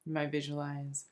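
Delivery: soft, soothing meditation voice with long pauses between phrases; unhurried, hushed relaxation guide; guided meditation. Acted. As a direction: gently guide them into calm, phrase by phrase.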